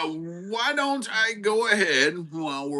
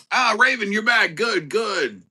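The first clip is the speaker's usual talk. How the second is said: usual speaking voice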